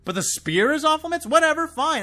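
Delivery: high, loud, incredulous rant; exasperated outburst. Real, not acted. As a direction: fan venting real fury at the writers, high and loud. Ranting about Beskar contradictions.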